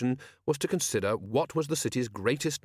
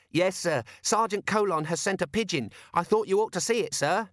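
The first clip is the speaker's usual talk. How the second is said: a bit higher, eager and polite; lightly voiced young dwarf; 8 semitones higher than usual. Corporal Littlebottom reporting to Vimes, lightly voiced eager. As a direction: report keenly to the boss. Cheery at the door.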